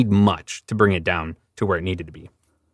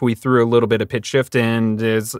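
ordinary delivery

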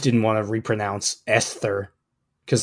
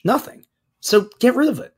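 higher, heated dismissal; 10 semitones higher than usual. Real, not acted. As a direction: scoff it away hotly. Heated rant about profile.

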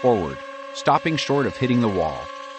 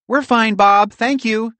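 high, louder chirping squirrel voice; chirpy squirrel; 8 dB louder, slower than usual. A narrator's reading. Pearl answers Bob from the window, high and chirpy. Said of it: "Pearl chirped in Squirrel".

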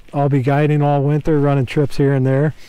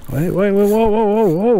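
rising higher with each whoa; excited whoas; 7 semitones higher than usual. Real, not acted. Fish thrashes by the boat, rising excited whoas.

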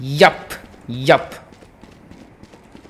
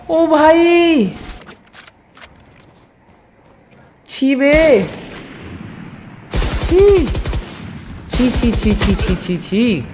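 disgusted laughing groan; 10 semitones higher, much slower than usual. Real, not acted. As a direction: squeal in embarrassment at yourself. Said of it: "खुद का game play देख के मुझे हंसी आ रही है". (अपने खेल पर शर्मिंदगी भरी हँसी)

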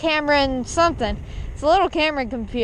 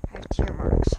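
whispering; 5 semitones lower than usual. Real, not acted. Showing wind-blown face.

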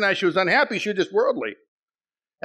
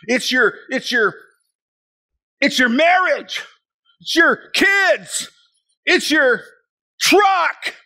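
mock accusing shout; 8 semitones higher, 4 dB louder, slower than usual. Mimicking the hateful accuser.

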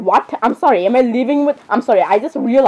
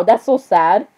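ordinary talk, nothing marked

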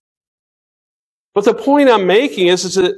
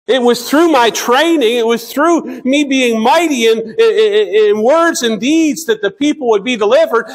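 higher-pitched, insistent voicing of Moses; preacher lightly voicing Moses' thoughts; Moses reasoning aloud; 5 semitones higher than usual. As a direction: lightly act out Moses making his case. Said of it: "he may have thought that".

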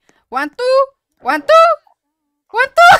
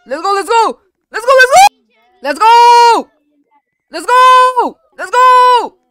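excited cheer; 6 semitones higher, 7 dB louder than usual. Real, not acted. Cheering a successful climb.

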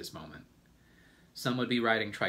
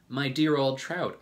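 higher, louder theatrical exclamation; crying out; 6 semitones higher, 7 dB louder than usual. A narrator's reading. Aunt Sponge answering her sister's boast, crying out. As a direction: call it out in mild indignation. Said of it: "Aunt Sponge cried out".

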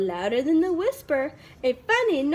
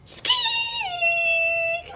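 tiny high squeak. Acted. Mouse squeak.

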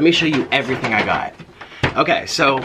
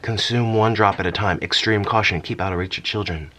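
ominous warning read; 7 semitones lower than usual. Pretend. Reading warning label, low.

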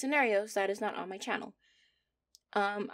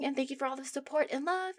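normal talking voice

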